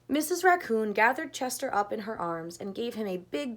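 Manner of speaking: plain reading voice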